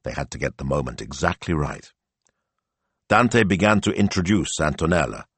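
plain reading voice

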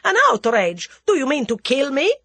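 loud, high-pitched panicky protest; Russian coward in loud, high alarm; high, hysterical. A narrator's reading. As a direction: cry out in hysterical disbelief. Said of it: "in a high, hysterical voice".

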